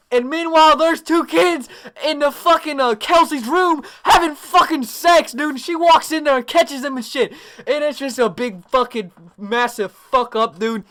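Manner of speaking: very high, loud storytelling yell; excited yelling at the climax of a story; worked-up shouting. Real, not acted. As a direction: shout the scandal out in disbelief.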